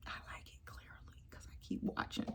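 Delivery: hushed confession; whisper. Real, not acted. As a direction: admit it in a whisper.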